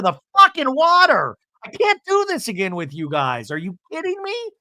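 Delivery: high, squealed comic outrage; mock exasperation. Host fed up with the volleyball, high exasperation. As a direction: burst out high, as if at wit's end.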